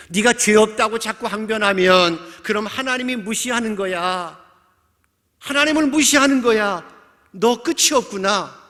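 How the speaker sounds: loud, high condemnation; indignant rebuke. Acted. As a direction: build to an outraged accusation. Friends condemning Job in retelling. (언성을 높여 꾸짖듯)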